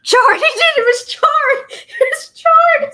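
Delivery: high, loud words through laughter; can barely get it out for laughing; laughing hard. Real, not acted. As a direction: squeal through the giggles. Laughing at the drive-thru name.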